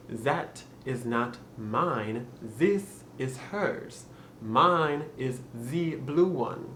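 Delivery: slow French accent, TH as Z; deliberate French-accented practice phrase. Shown as a demonstration. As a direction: demonstrate a French accent slowly, "zis" for "this". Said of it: "saying that phrase with the French accent".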